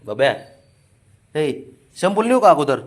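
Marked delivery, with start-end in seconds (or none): 1.4-2.8 calling across. Real, not acted.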